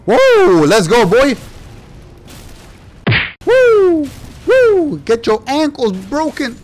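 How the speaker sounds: loud, high triumphant whooping; victory hype. Real, not acted. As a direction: celebrate the kill like a win. Victory over enemy.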